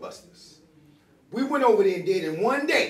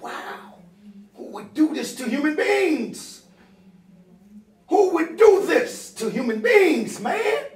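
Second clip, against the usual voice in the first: shocked disbelief; 9 semitones higher than usual. Retold or imitated. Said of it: "I'm like".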